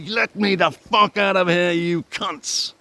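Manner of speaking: high, slow mock roar of rage; imagined furious yell. Locked in a cell, yelling at police over the intercom, furious. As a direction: bellow it through the intercom, raging. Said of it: "so desperate to get on that intercom and say".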